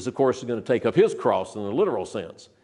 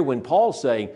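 normal talking voice

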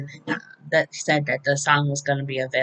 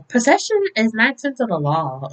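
higher, playful mock retort; put-on character voice; 6 semitones higher than usual. Trina claims the letter from Spencer, hammed-up. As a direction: exaggerate her lofty claim in a raised voice. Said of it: "she didn't talk like that".

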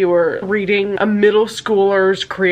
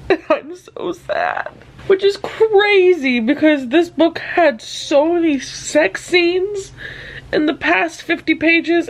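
real teary voice, slow and a bit higher; talking through real tears; crying over a book; 7 semitones higher, slower than usual. Real, not acted. Tearing up to viewers over the ending, real crying. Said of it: "I can't stop crying right now".

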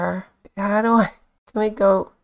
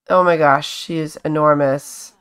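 usual speaking voice

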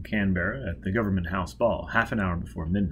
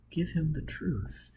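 breathy whispered lines; feed him the line in a whisper; whispered prompt; 6 semitones higher, 8 dB quieter than usual. Acted. Robin whispering the witty answer to Foyle.

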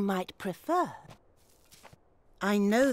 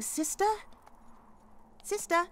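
calls rising higher each time; calling out; 10 semitones higher than usual. Acted. Duck calls her sister at the cave, rising. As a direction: call sister up toward the cave.

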